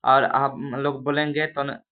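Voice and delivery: ordinary talk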